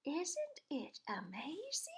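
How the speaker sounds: a hint of a slow, marvelling old lady; gently amazed, drawn out slowly; lightly voiced old lady. Old lady watching the ducks, lightly voiced slow wonder. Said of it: "An old lady from Beacon Hill said".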